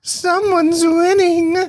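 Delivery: high, slow teasing singsong. Real, not acted. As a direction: draw it out to tease. Teasing vote tally.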